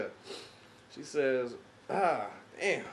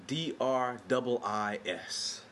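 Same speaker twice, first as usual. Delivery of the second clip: slow spelling out; 3 dB quieter, much slower than usual. Retold or imitated. Spelling the name for clerk.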